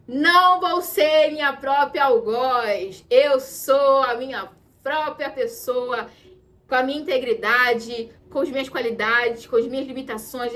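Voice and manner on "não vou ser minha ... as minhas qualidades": slow solemn vow. Real, not acted. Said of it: "segura aqui minha mão".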